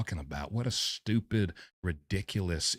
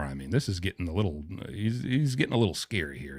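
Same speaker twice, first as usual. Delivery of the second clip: usual speaking voice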